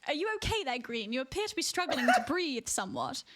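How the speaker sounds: politely concerned posh British; posh British accent. Pretend. Streamer checking on breathless friend, posh British accent. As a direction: check on them in proper British English. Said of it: "posh British accent".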